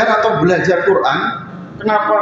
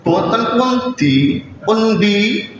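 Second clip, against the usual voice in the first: slow, deliberate Javanese words; Javanese phrase; much slower than usual. Shown as a demonstration. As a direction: say it like a non-Javanese learner trying Javanese. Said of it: "gimana contohnya". (ucapan bahasa Jawa yang pelan dan kaku)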